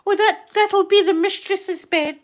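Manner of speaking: high, loud, slow theatrical woman; hammed-up historical re-enactor; costumed castle character. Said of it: "she's in character to this woman".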